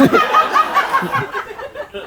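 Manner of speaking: breathy high-pitched shriek of laughter. Real, not acted. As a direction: squeal with laughter. Laughing at own writing.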